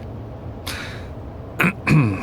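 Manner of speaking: soft throat clear. A narrator's reading. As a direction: clear the throat quietly before going on. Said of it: "He cleared his throat".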